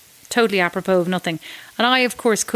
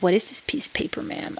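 whispered, softer policeman; 5 dB quieter than usual. Retold or imitated. Policeman quizzing her licence, in a softer whisper. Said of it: "so I give him my license".

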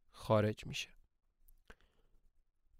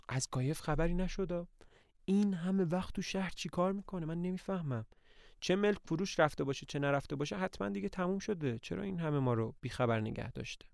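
asked again much softer, higher and uneasy; softer anxious repeat; 4 semitones higher, 6 dB quieter than usual. Acted. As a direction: worry it out under the breath. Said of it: "باز میگه".

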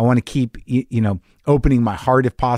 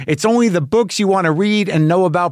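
upbeat podcast ad read, a bit higher; 5 semitones higher than usual. Acted. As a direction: sell the show, friendly and bright. Host reads podcast ad.

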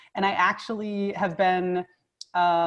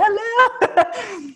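high, loud, laughing hello; mock attention call; 13 semitones higher, 5 dB louder than usual. Therapist waving down a distracted student, high laughing call-out.

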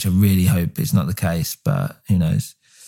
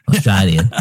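put-on Australian accent. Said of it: "I've gone Australian".

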